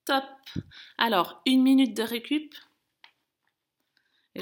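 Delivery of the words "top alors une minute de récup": loud announcement. Real, not acted. Coach announces recovery after effort.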